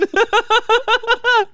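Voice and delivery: loud squealing laugh; high burst of laughter. Real, not acted. Big laugh.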